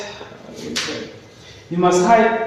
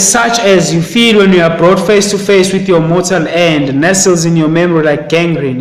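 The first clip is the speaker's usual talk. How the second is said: louder, weighty read of the text; loud emphatic reading; 7 dB louder than usual. Acted. Teacher reads Pi's words on fear to pupils, loud and emphatic. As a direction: boom the quote out to the class.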